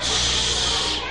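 whispered shh; shushing. Real, not acted. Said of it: "hushing the children".

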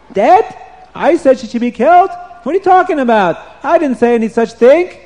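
very high, loud disbelief; shocked king. Acted. King hears his wife is dead, high, loud and shocked. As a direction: cry out in disbelief.

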